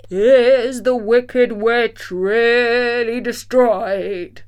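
slow, loud, booming disembodied voice; trembling wizard voice. A narrator's reading. The hidden Oz asks about the Witch, trembling. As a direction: draw it out loudly as a wavering wizard. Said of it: "thought it trembled a little".

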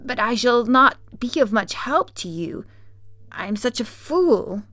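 lightly theatrical self-reproach; Scarecrow. Acted. Scarecrow admits he is no help.

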